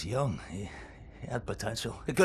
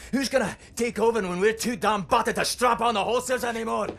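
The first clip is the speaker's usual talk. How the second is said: raised, louder exasperated rant; heated rant; 7 semitones higher, 5 dB louder than usual. Acted. Old fighter ranting at Blazkowicz about the future, heated. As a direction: demand an answer he can't give.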